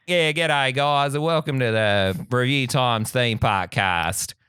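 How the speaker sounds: broad, slow Australian drawl; hammed-up outback Australian accent; true Australian accent. Shown as a demonstration. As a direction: put on a thick Aussie podcast welcome. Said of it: "consider the true Australian accent".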